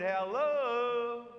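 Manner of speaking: calling out. Retold or imitated. Boy calls out across valley.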